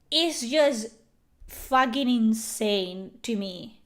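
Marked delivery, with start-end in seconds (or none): none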